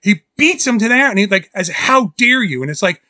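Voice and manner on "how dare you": higher-pitched indignant reproach; lightly acted scolding; offended, stressed rebuke; mock outrage. Acting out Karloff scolding the shooter.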